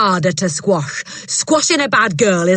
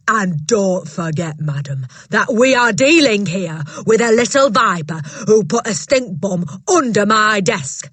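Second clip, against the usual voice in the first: loud, bullying headmistress voice, higher; booming headmistress; 4 semitones higher, 5 dB louder than usual. Acted. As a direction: bark it down at a timid teacher. Trunchbull voiced in story.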